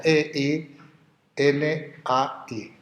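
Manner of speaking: E I N A I spelled out slowly as single letters. Shown as a demonstration. Teacher spelling the Greek word for students, letter by letter. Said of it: "como suena".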